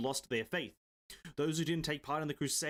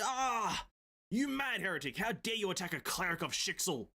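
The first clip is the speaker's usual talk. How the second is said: mild indignant cleric; 5 semitones higher than usual. Acted. Voicing outraged cleric.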